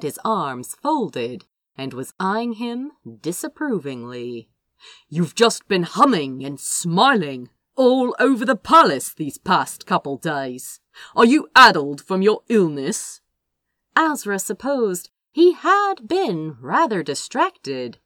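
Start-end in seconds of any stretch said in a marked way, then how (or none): 5.1-13.2 a lightly voiced stern brother, louder at the end; reproachful brother asking if he's addled; disapproving older brother. A narrator's reading. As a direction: chide him like a fussy elder sibling. Michael questions Azra disapprovingly.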